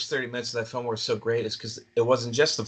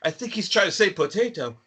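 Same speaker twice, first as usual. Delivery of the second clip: mock Welsh accent; 7 semitones higher than usual. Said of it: "And the Welsh guy was like".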